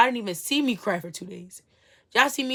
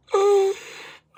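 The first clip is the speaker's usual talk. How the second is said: loud sigh; 12 semitones higher, 8 dB louder than usual. Real, not acted.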